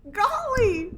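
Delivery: high laughing "Golly". Pretend. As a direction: giggle out the word "Golly". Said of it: "We'd be like".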